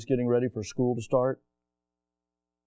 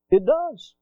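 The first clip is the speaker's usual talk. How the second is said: excited affirmation; 10 semitones higher, 4 dB louder than usual. Shown as a demonstration. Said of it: "repeated answer, raised".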